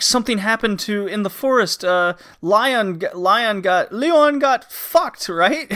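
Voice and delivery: worried daughter. Retold or imitated. Daughter telling mother about the forest, high and anxious. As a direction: blurt the news to mother, anxious. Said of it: "you're just like".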